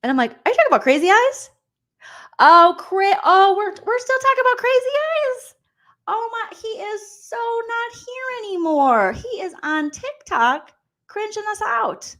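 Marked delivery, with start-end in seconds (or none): 0.3-1.5 quick, high-pitched outburst; mock incredulous question. Recapper mimicking a housemate's reaction, mock incredulous. 2.4-5.5 very high, loud whiny outburst; mock exasperated wail. Recapper reacting to a housemate's talk, mock exasperated wail. 6.1-12.1 drawn-out, squeaky high complaint; high mock dismay. Recapper mocking someone cringing on TikTok, high whiny dismay.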